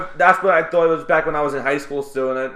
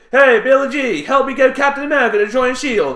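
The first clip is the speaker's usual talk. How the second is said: put-on hillbilly accent; 9 semitones higher, 7 dB louder than usual. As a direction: parody a Southern-country accent loudly. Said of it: "trying to do a hillbilly".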